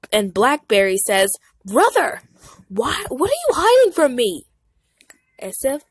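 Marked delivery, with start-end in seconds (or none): 1.6-4.5 high, loud suspicious question; demanding brother. Acted. Blackberry demanding what his brother hides, high and loud. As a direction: press him like a suspicious little boss.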